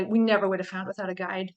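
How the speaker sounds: normal talking voice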